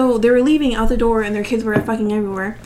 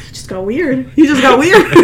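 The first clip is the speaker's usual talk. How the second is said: laughing; 4 semitones higher, 6 dB louder than usual. Retold or imitated. Retelling reaction, laughing.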